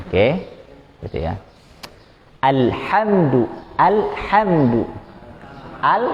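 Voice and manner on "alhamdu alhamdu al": slow, high "Alhamdu" with clear A, unbounced L and clean ḥ; clean mid-throat H. Shown as a demonstration. Teacher modelling recitation, slow with a clean H. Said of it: "H-nya bersih".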